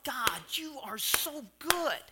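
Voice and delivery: slow, heartfelt thanks to God; grateful awe. Retold or imitated. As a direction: savour each word in gratitude. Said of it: "because I thought".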